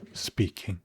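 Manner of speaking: ordinary talk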